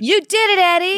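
delighted praise; excited cheer. Real, not acted. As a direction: cheer him on. Cheering his success.

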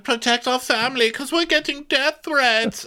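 very high mocking voice; mock Diana. Podcaster still mocking Diana, very high. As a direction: keep squeaking the excuse.

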